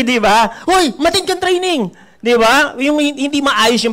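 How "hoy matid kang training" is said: rude mentor shout. Retold or imitated. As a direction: bark the order rudely. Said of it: "Mainis ka eh".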